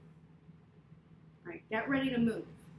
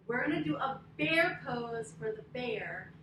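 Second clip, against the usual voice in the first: usual speaking voice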